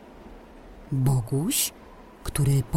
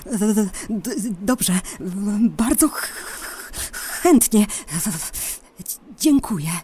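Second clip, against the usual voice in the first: stammering from cold, shivering; teeth chattering; 5 semitones higher than usual. Acted. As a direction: chatter the words, freezing. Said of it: "powiedział Boguś, szczękając zębami". (szczękanie zębami)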